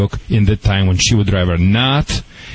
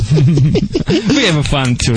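laughing; 6 semitones higher, much slower than usual. Real, not acted. Host laughing on air.

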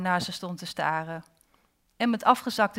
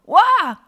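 loud, high-pitched yell; startled scream; 12 semitones higher, 12 dB louder than usual. A narrator's reading. Girl seeing a face in the water, screaming. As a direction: let out a sudden shriek. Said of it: "Toen gaf ze een gil".

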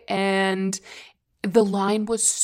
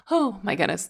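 ordinary talk, nothing marked

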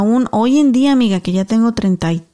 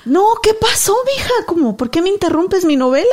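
much higher, irritated grandma; annoyed grandma; 10 semitones higher than usual. Retold or imitated. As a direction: play the grandma, annoyed at the interruption.

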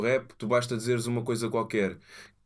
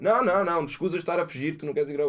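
mock accusing; 6 semitones higher, faster than usual.

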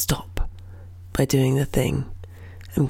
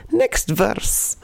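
cheery; 4 semitones higher than usual. Retold or imitated. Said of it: "punctuated with a cheery".

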